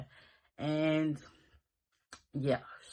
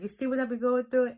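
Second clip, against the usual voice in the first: high, louder mock complaint; mock exasperation; 9 semitones higher, 4 dB louder than usual. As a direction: imitate an exasperated friend, pitched way up. Friend exasperated by husbands.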